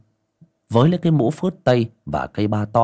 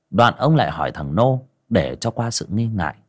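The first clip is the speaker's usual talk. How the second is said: ordinary delivery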